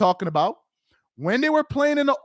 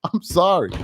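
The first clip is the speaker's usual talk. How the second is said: usual speaking voice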